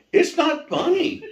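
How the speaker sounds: high, loud protest while laughing. Retold or imitated. [name] tells laughing Fred it isn't funny, laughing himself. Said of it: "And I'm like".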